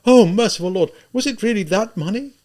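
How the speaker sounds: high, loud, horrified questioning; distraught woman, high and urgent; sudden cry. A narrator's reading. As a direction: cry it out as the awful thought strikes. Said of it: "she cried suddenly".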